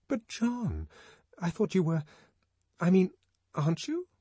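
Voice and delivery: high, flustered questioning; stumble, puzzled and high; confused woman voice. Acted.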